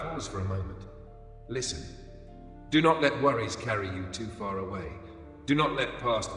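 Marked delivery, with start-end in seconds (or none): none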